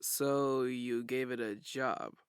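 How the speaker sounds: very slow, stretched-out words; slow and drawn out. Acted. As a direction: drag each word out slowly. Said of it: "my words came out slowly and drawn out".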